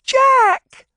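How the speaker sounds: very high, loud frightened cry; cried out. Acted. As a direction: scream his name in fear for him. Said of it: "she cried".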